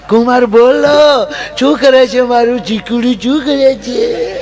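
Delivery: high, slow, doting mother-in-law voice; gushing mother-in-law. Acted. Mother-in-law greets son-in-law on phone, high doting coo. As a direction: coo over him like a doting mom.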